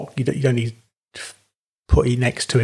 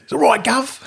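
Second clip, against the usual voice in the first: high mock-Cockney greeting; exaggerated Cockney impression; Cockney accent; 11 semitones higher than usual. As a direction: put on a jokey Cockney, pitched up. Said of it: "Why do they all sound like Cockneys?".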